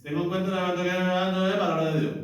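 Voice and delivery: fast, no pause, run on; rattled off without a break; rushed together. Shown as a demonstration. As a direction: gabble it all in one breath. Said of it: "no todo seguido".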